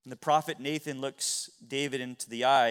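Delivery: ordinary talk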